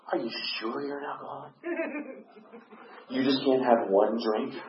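soft, doubting voice of a friend; imitated friend. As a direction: mimic a friend gently coaxing, in no hurry. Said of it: "this other guy starts going".

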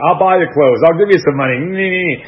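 lightly voiced recruiter desperate to keep him; urgent, coaxing recruiter voice; pleading recruiter. Recruiter begs him to stay.

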